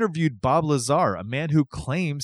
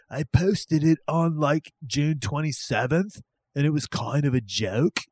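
put-on voice for quoting the prankster, slightly raised at first; imitating the prankster. Host voices Roberts's quote to his co-host, mock prankster voice. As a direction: read the quote in a hammed-up invented accent. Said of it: "I don't know that he sounds like that".

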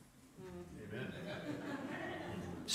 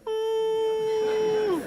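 knowing hum. Retold or imitated. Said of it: "And Jesus is like".

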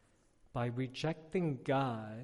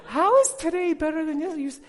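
much higher and louder, fast laughing disbelief; laughing exasperation; 12 semitones higher, 12 dB louder than usual. Retold or imitated. Father mock-asking his cheerful daughter, laughing loudly.

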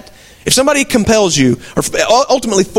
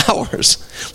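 breathy whispered reply; whispered answer; 6 dB quieter than usual. Acted. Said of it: "Bruised for whose iniquities".

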